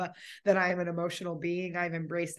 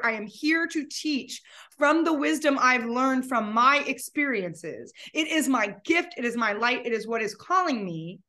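louder, emphatic self-defense; impassioned retort; 4 semitones higher, 5 dB louder than usual. Retold or imitated. Answering someone who said just grieve, impassioned and firm. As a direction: declare it with full conviction.

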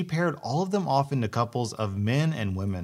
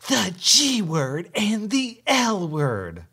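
hissed half-shout, high and slow; whisper yelling; 8 semitones higher, slower than usual. Retold or imitated. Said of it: "The entitled mother whisper yelling".

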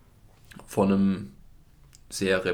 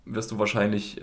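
normal talking voice

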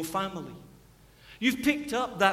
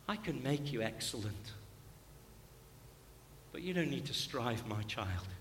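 low, very soft fatherly voice; tender reassurance; 7 semitones lower, 12 dB quieter than usual. Acted. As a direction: reassure a child gently, with long pauses. God as Father reassuring a child.

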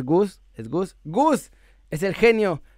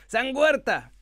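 San Huerta raised high; reverent saint call; 6 semitones higher, much slower than usual. Shown as a demonstration. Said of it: "como un santito". (San Huerta en tono alto y solemne)

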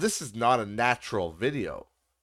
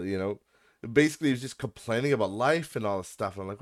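ordinary talk, nothing marked

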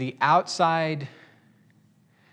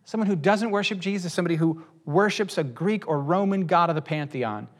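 ordinary delivery

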